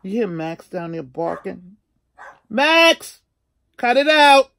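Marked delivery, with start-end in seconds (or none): 2.5-4.5 loud, high yell across the house; yelling at the dog. Real, not acted. Scolding her barking dog Max from upstairs, real loud yell.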